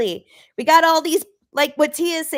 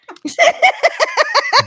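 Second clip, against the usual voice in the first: loud laugh; 14 semitones higher, 7 dB louder than usual. Real, not acted. Big laugh at joke.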